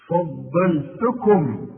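فضلتكم said very slowly with every vowel full; correct recitation. Shown as a demonstration. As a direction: read فضلتكم slowly and clearly. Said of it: "الفه في القرآن فا". (نطق فضلتكم ببطء شديد وواضح)

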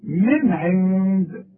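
ordinary talk

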